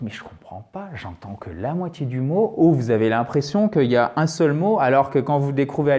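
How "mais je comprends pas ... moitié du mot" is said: softly voiced learner's complaint; puzzled learner. Teacher voices a learner listening to English, softly puzzled. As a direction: drop to a quieter aside as the confused student. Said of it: "vous dites".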